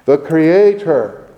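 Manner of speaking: Creator slowed and weighted; stress on Creator. Shown as a demonstration. Preacher underlining Creator in Isaiah, slow and stressed. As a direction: draw out the Creator. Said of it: "underline that word".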